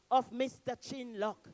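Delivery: usual speaking voice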